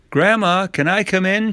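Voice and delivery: put-on high little-girl call. A narrator's reading. Girl asks Grandma to let her in, calling. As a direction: call sweetly through the door. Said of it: "she called".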